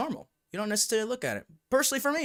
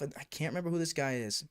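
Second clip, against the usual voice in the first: ordinary talk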